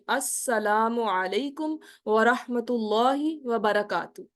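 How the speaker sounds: very slow, every sound of السلام علیکم ورحمۃ اللہ وبرکاتہ given in full; correct careful salam. Shown as a demonstration. Teacher modelling the correct salam, slow and clear. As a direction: recite it slowly like a prayer. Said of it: "بالکل صحیح سے پڑھنی ہے".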